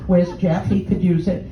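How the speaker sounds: ordinary delivery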